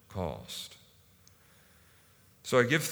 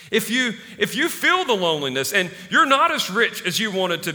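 loud, emphatic sermon delivery; impassioned preaching; 7 semitones higher, 9 dB louder than usual. Real, not acted. Preacher assuring struggling believers they are on track, impassioned preaching. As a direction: drive each point home to the congregation, voice raised.

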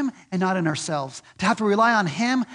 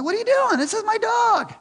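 much higher, squeaky mock outrage; a hammed-up, high-pitched "what are you doing" at the dog's owner; indignant outburst; 3 dB louder than usual. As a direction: play your own shocked owner voice, pitched way up. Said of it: "I'm like".

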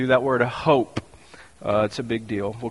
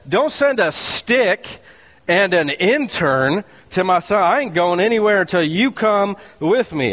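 defiant; 6 semitones higher than usual. Acted. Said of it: "She said".

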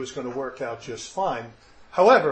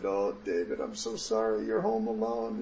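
soft, remorseful fatherly apology; retold apology; 7 semitones lower, 6 dB quieter than usual. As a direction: apologize gently, as if reliving it. Father apologizing to his child.

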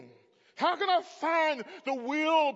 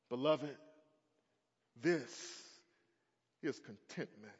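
ordinary delivery

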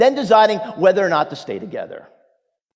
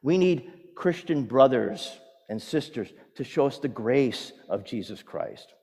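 ordinary talk, nothing marked

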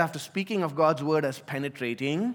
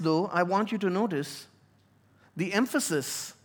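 normal talking voice

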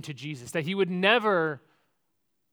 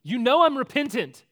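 raised, louder, earnest declaration; repentant; 6 semitones higher, 8 dB louder than usual. Acted. Peter's repentant heart declaring itself to Jesus, loud and earnest. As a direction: cry it out high, then fall at the end. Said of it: "what does Peter's repentant heart say?".

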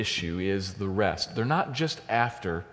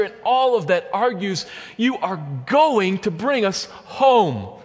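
high, loud, fervent sermon climax; raised pulpit voice, driving every phrase home; full-force preaching; 8 semitones higher, 5 dB louder than usual. Real, not acted. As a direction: proclaim it with utter confidence in God.

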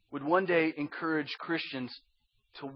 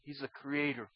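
much softer, lower, reverent testimony; hushed, solemn; 4 semitones lower, 8 dB quieter than usual. Acted. Apostle tells who he is, hushed and solemn. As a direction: drop to a grave hush, pausing between phrases.

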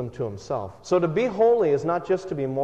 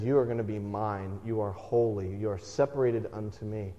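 solemn voice of God; 4 semitones lower, 6 dB quieter than usual. Acted. God claiming his people.

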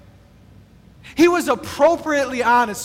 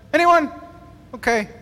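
ordinary talk

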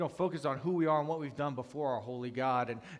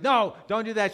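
loud, higher retold objection; alarmed protest; 9 semitones higher, 12 dB louder than usual. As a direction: jump in to stop her, acting it out. Acted objection to wife's attempt.

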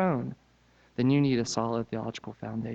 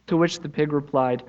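lively storybook reading, pitched up; story narration; 5 semitones higher, 6 dB louder than usual. A narrator's reading. Preacher reading the pigs story to church, animated. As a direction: tell it like reading to children. Reads children's story aloud.

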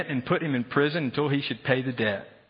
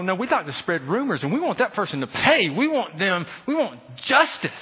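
vindictive grumbling; 5 semitones higher, 4 dB louder than usual. Retold or imitated. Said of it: "We go".

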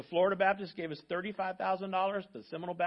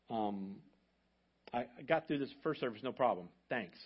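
emotional; 6 semitones lower, 6 dB quieter than usual. Real, not acted. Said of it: "I'm gonna get emotional".

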